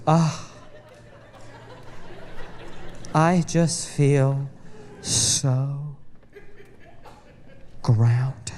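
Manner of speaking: slow, low, dreamy sigh of contentment; blissed-out wife. Retold or imitated. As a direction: savour each word like a spa client. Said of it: "she just goes".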